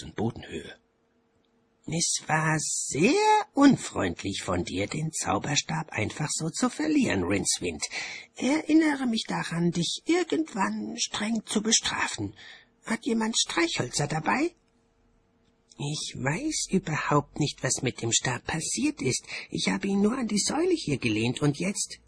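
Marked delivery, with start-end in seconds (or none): 1.9-14.5 high-pitched, put-on scolding voice; high, measured reproach from an official; prim bursar character. A narrator's reading. The bursar speaks in the story. 15.8-22.0 high-pitched, defensive excuse; high, bewildered self-defence; flustered Rincewind character. A narrator's reading. Rincewind answers in the story.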